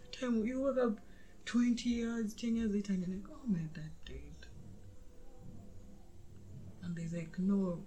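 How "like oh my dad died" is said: soft flat whisper. Retold or imitated. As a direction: whisper it without sadness. Calm whispered quote.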